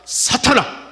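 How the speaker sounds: mild angry rebuke. Acted. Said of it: "어떻게 분노하셨습니까".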